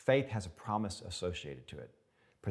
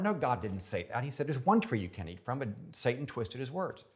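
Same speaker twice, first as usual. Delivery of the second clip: usual speaking voice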